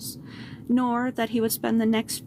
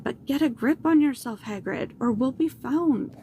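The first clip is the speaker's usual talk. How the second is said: ordinary delivery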